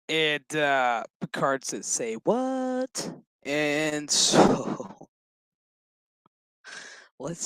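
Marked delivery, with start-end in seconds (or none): 1.9-3.1 high, drawn-out rising question; mock disbelief. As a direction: imitate incredulity, swooping up high and holding it. Voices Picard's disbelieving reply.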